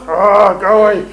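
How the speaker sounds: loud, exaggerated rejection; mock brush-off. Showing brothers the wrong extreme when a young girl walks by, mock rejection. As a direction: push them away with a loud sigh.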